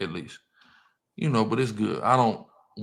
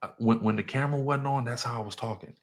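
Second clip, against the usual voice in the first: ordinary delivery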